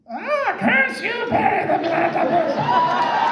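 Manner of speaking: high, drawn-out villain's cry of frustration; Doctor Doofenshmirtz. Acted. Actor on the phone in a restaurant, as Doctor Doofenshmirtz. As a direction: wail the curse like a cartoon evil scientist. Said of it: "Doofenshmirtz is not a character you can really do quietly".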